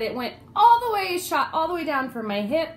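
loud, high re-enacted protest; pained complaint. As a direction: protest loudly about the pain. Retells pained complaint to doctor.

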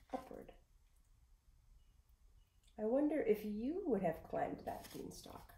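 usual speaking voice

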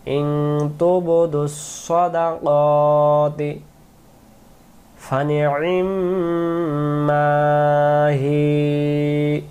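slow melodic tajweed chanting; slow chanted Quranic recitation. Shown as a demonstration. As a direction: draw the vowels out in a sung recitation. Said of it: "এখানে দম ফেলাও যায়".

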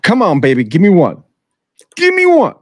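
loud, high pleading chant; urging dice throw. Real, not acted. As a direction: beg the dice for a win, rising.

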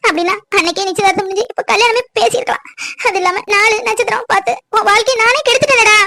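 tearful pleading, pitched high; a guilt-stricken mother weeping; crying mother. Acted. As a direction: sob out the apology to your son. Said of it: "அழாதீங்கம்மா".

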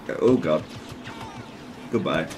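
ordinary delivery

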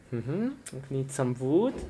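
usual speaking voice

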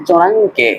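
চুনাংকে with an "ng" before "ke"; wrong nasal pronunciation. Shown as a demonstration. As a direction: close the middle of চুনাংকে on a sounded "ng". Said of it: "এরকম উচ্চারণ হবে না". (চুনাংকে, ভুল করে "ং" স্পষ্ট করে)

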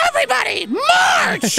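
high shouted command; army commander shout. Pretend. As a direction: bark the order high. Voicing army Toad leader.

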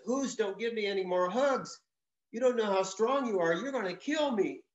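a hint of a squeezed little bird; lightly pleading bird. Owl begging the bear to stop hugging, mildly pleading.

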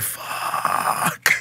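long, breathy, whispered sound effect; like air leaking out in awe; soft drawn-out curse. Retold or imitated. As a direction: exhale the word slowly without voice. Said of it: "I let out a soft fuck".

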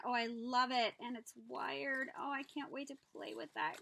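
ordinary talk, nothing marked

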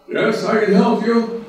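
high, loud booming answer; a hammed-up God speaking from above; God's voice. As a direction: answer like a calm almighty voice.

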